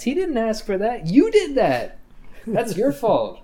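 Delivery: high, emphatic telling-off; mock scolding. Dad scolding a mom for putting her kid on blast, indignant. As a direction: lay the blame with rising indignation.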